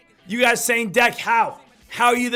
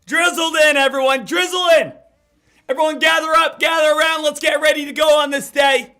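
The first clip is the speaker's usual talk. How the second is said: hype host; 4 semitones higher, 6 dB louder than usual. Acted. Pumped-up morning show opener.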